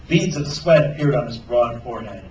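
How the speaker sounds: ordinary delivery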